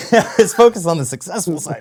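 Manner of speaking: joking, laughter-broken delivery; laughing. Real, not acted. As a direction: laugh through the words. Joking about hunting success.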